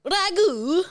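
put-on Italian accent. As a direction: say it with Italian flair. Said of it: "anything in an Italian accent".

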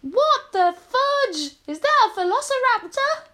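overacted high-pitched shock. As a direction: blurt it out in comic alarm. Deafened character spots a dinosaur.

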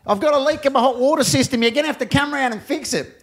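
high, fast complaining voice on a voicemail; annoyed caller. Retold or imitated. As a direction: rattle off the complaint like a fed-up customer. Caller leaving an annoyed message.

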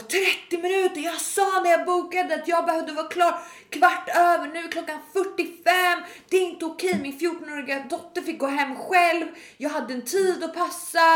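high-pitched mock outraged rant; losing it. Angry customer berating salon girls, high indignant rant. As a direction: imitate a customer piling up complaints indignantly. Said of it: "lackar ur på de här stackars tjejerna".

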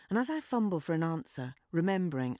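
plain reading voice

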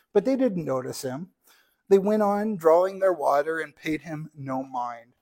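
lively read-aloud storytelling voice; story narration. Acted. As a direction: read the parable to the congregation like a storybook.

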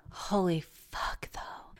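breathy whispered swear under her breath; hushed stunned whisper; shocked whisper. Real, not acted. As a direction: whisper it like you can't believe it. Stunned aside at the news.

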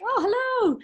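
high, surprised little hello, half joking; mock surprised hello. Mock greeting to wheeled-in midwife.

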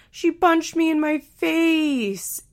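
high, slow mocking imitation; mocking whine. Reading Amber making fun of Andrew's complaint, high drawn-out mocking. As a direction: drag it out high to mimic a complainer. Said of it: "by mocking Andrew".

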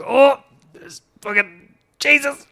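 high, loud, drawn-out cry; comic re-enacted yell of shock; retold crash cry. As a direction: blurt it out as if just hitting a tree. Retold cry on hitting the tree.

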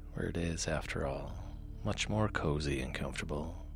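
soft, low bedtime reading; sleep story narrator. A narrator's reading. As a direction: read gently to lull listeners to sleep.

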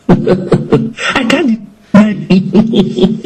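slow, laughing mock boast; hammed-up laughing Peter. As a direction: laugh through the bragging promise. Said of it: "Peter was saying".

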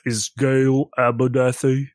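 a hint of a threatening tough guy, unhurried; menacing character voice. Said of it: "How deep of a voice?".

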